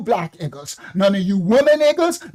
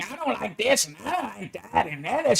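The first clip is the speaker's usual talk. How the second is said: 1 mock whiny complaint; 2 semitones lower, 9 dB quieter than usual. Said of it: "You got the freedom to complain".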